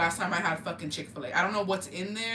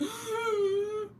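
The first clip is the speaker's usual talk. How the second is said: sharp high gasp for air; retold gasp after falling on icy stairs; winded gasp. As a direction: suck in air like the wind was knocked out. Knocked breath out on stairs.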